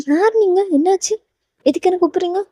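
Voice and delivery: high, soft, puzzled voice; puzzled questioning. Acted. Heroine to two strange girls calling her, puzzled. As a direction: ask lightly and high, unsure who they are.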